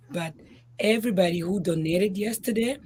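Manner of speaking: ordinary delivery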